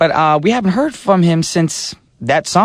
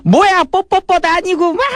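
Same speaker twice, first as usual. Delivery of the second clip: imitating mom, much higher and very fast; mocking mom; much faster than usual. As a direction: tease like a mom laughing at the name, rapid. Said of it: "And my mom goes".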